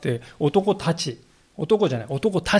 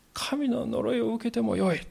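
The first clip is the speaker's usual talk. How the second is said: "神の呪いを受けてもよい" said higher and softer, like a cornered man swearing; cornered oath; 7 semitones higher, 5 dB quieter than usual. Retold or imitated. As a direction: swear "神の呪いを受けてもよい" in a hushed, strained high voice. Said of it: "とまで言わなくてはならないほどにペテロは追い込まれた". (追い詰められた誓い)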